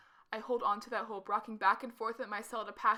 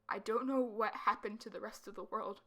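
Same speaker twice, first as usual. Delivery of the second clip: ordinary delivery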